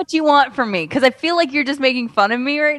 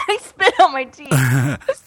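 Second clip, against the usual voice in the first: laughing; much slower than usual. Real, not acted. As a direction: laugh your way through the admission. Cracking up after a slip.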